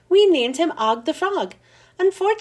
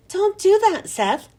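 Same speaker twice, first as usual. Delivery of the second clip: warning; 8 semitones higher, 3 dB louder than usual. A narrator's reading. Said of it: "the teacher warned him".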